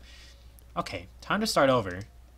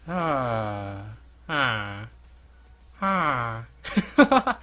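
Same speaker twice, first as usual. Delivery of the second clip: lightly voiced regal figure; a hint of a haughty royal; royalty voice; 5 dB louder, much slower than usual. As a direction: try on a mild royal air. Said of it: "I'm gonna do a royalty voice".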